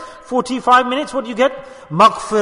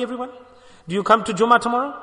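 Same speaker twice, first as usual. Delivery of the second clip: normal talking voice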